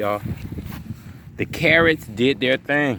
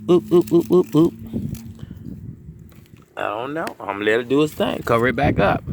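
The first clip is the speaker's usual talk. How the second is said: normal talking voice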